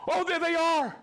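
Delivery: excited. Real, not acted. Delighted to spot regular viewers.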